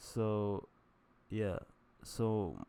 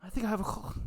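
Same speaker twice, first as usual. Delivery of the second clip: higher whiny complaining; whining excuse; 13 semitones higher, faster than usual. Retold or imitated. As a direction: whine like a kid avoiding the cold. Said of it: "I'd be whining".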